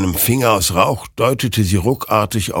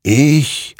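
loud, raised, portentous single word; emphatic murmur; 9 semitones higher, 6 dB louder than usual. A narrator's reading. Rider answers who won the war, emphatic and portentous. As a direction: claim it like a triumphant spirit. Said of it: "murmelte dieser".

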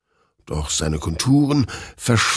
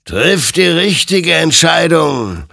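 high, loud, drawn-out warning; stern admonition; 10 semitones higher, 7 dB louder, slower than usual. A narrator's reading. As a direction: admonish him slowly, with a ringing raised voice. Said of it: "ermahnte ihn sein Gegenüber". (laut und gedehnt ermahnend)

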